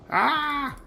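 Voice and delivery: high-pitched "ah" of recall; sudden "ah". Real, not acted. Streamer recalls the forgotten base name, high exclaimed "ah". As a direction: let out a high, drawn "ah".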